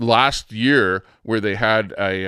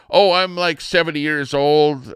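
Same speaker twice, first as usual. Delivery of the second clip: high, loud mock interviewee; imitating older guest; 6 semitones higher, 5 dB louder than usual. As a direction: mimic the woman's announcement in a raised voice. Retelling the older guest's words.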